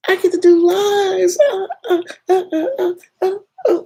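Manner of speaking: very high, stammering squeals of joy; real delight, breaking into high squeals; excited squeal. Real, not acted. Thrilled about doing lives.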